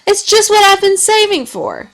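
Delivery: put-on overjoyed kid. A narrator's reading. Henry thrilled by gift.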